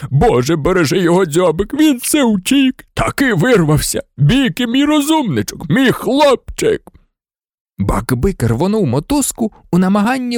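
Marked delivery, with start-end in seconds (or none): 0.1-6.8 sobbing. A narrator's reading. Hagrid blessing his escaped Beaky, sobbing. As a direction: cry out with overwhelmed relief. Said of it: "ридав він".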